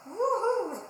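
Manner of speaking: high, loud woohoo; excited cheer. Real, not acted. Cheering the parachute project.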